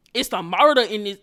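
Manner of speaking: loud punch on "murder"; emphatic exclamation. Retold or imitated. Telling viewers about the bloody nose, emphatic exclamation. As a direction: hit "murder" hard and loud. Dramatizing the nosebleed scene.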